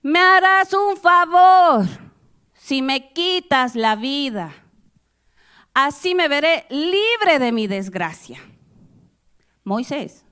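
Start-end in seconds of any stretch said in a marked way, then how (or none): 0.0-8.4 high, slow, shouting lament; loud exasperated plea. Acted. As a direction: plead to be relieved, at full voice. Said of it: "nótese de nuevo signos de admiración".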